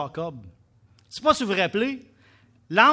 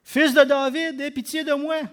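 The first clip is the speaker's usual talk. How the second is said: higher, louder desperate plea; crying out louder; 6 semitones higher, 7 dB louder than usual. A narrator's reading. Blind man shouting over the crowd, a louder plea. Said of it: "il criait beaucoup plus fort".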